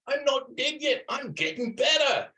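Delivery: high, slow put-on squeaky protest; hammed-up feeble insistence; protesting old man. Teacher acting the skit for the class, high slow comic protest. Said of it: "He goes".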